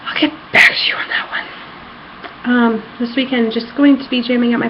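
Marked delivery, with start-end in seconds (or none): 0.1-1.5 whispered, embarrassed; a shy whispered aside; sheepish whisper. Real, not acted. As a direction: breathe it out, half laughing at yourself. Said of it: "whispered after forgetting".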